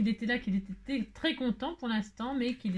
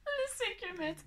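mock echo; 12 semitones higher, 6 dB quieter, slower than usual. Mocks herself, echoing her words.

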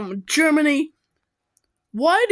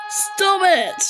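firmer repeat; 5 semitones higher than usual. Real, not acted. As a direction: insist the second time.

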